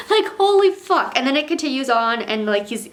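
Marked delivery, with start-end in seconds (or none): 0.1-1.2 high, loud burst of delight; excited outburst. Real, not acted. Reacting to the book's love scene, a high, loud excited outburst. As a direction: gush out your shock at the scene.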